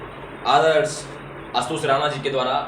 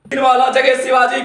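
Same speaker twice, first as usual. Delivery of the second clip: high, louder heroic verse; fiery declamation; 10 semitones higher, 8 dB louder than usual. Acted. Reciter invoking Shivaji, fiery declamation. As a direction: proclaim it like a battle cry. Verse repeated at full force.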